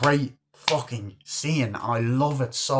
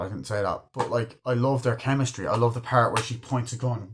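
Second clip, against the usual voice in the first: normal talking voice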